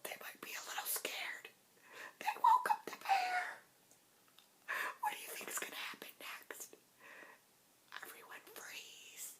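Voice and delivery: hushed suspense whisper. Acted. Telling the children to freeze, in a whisper. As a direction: whisper like the bear might hear. Suspense after bear wakes.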